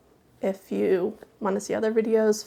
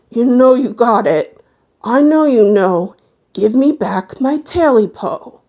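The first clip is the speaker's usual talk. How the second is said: loud creature demand; louder, pressing the demand for the tail; the creature in the swamp calls the man louder; 3 semitones higher, 10 dB louder than usual. Acted.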